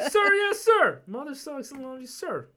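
hammed-up boot-camp reply; mock recruit shout. Recruit answering the sergeant.

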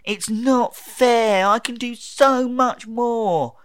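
high, drawn-out mock whine; Tenth Doctor. Mimicking the Doctor's self-pity, whiny and overblown. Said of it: "ranting and raving about how it's not fair".

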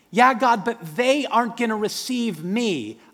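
high anxious plea; whiny Moses. Acted. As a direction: drag out the excuse, pleading. Moses arguing with God.

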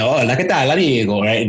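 Spanish words in an Italian accent. Shown as a demonstration. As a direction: swing the syllable timing the Italian way. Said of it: "Spanish words with Italian accent".

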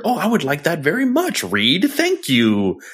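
high, quick, sugary neighbor voice; sweet neighborly Griever. Retold or imitated. Mocking Griever thanking Reed, high sugary neighbor voice. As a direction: gush it like a polite neighbor. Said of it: "your next door neighbor".

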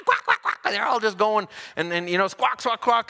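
lightly imitated duck quacks and goose squawks; mock quacking. Telling the congregation about the park birds, playful mock quacks.

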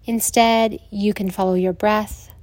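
soothing Yoga Nidra guidance with long pauses; guided meditation. Acted.

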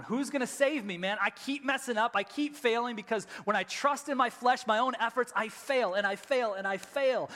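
a lightly voiced struggling apostle; anguished Paul. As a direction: cry out like a man failing. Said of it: "where he's going".

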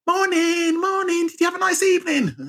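very high singsong hello; put-on cheery greeting. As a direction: chirp office greetings in a high, mock-cheerful voice. Imitating chirpy office greetings.

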